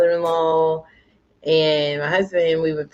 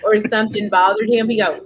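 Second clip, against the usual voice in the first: ordinary delivery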